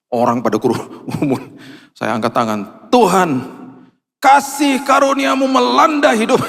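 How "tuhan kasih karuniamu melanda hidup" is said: raised ringing prayer voice, much higher. Retold or imitated. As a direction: cry out to God over the stranger. Praying aloud for the man.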